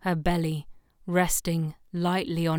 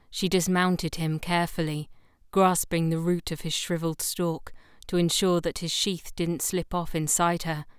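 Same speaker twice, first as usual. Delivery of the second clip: ordinary delivery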